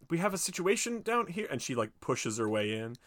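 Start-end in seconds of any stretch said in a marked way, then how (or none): 0.1-1.5 mock doorman alarm. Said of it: "and he's like".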